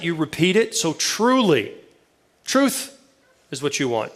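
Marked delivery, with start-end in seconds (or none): 0.9-1.7 "truly" set apart, pitched higher; emphatic TRULY. Shown as a demonstration. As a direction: lean on "truly" as if in bold. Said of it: "If they wanted to emphasize it".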